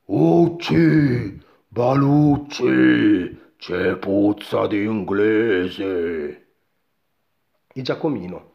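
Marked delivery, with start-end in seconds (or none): 0.1-6.5 slow, loud ogre voice; booming giant. A narrator's reading. Giant smells an Englishman, slow booming big voice. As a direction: boom it out, vowels long and drawn. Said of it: "un rimbombante vocione".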